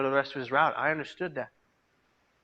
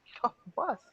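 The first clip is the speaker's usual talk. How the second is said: high, pleading plea to himself to get off the bus; urgent self-warning; 5 semitones higher, 6 dB quieter, faster than usual. Retold or imitated. Young man urging himself to get off the bus, high and pleading. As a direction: snap yourself out of it, pitched high. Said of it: "I said".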